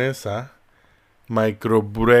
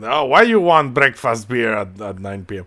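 put-on Russian accent; 4 dB louder than usual. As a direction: imitate a gruff Russian bartender. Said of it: "Tien-, tiene acento ruso".

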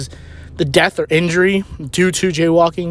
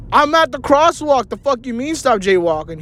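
indignant protest; 7 semitones higher than usual. Retold or imitated. Protesting to his cousin at the crosswalk, indignant.

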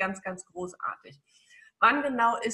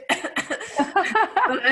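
embarrassed laugh; 10 semitones higher, 5 dB louder than usual. Real, not acted. Laughs at own choking joke.

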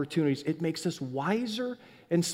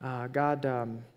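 prayer; 4 semitones lower, 5 dB quieter than usual. Real, not acted. Starts leading prayer.

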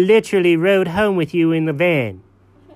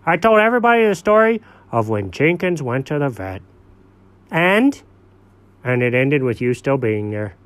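normal talking voice

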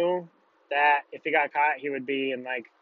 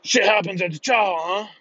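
raised, strained instructor voice; drill instructor; 5 semitones higher, 4 dB louder than usual. Retold or imitated. Drill instructor confronts two recruits caught wrestling, strained bark. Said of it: "the drill instructor says".

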